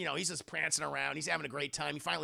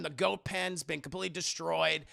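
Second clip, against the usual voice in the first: ordinary talk, nothing marked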